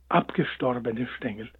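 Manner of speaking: plain reading voice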